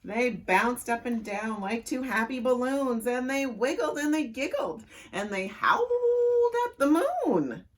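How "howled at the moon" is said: much higher laughing howl; storyteller laughing through the howls. Acted. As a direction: howl while cracking up.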